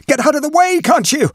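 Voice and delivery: high, loud panicked yell; frantic shout. Acted. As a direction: shove past him yelling in panic.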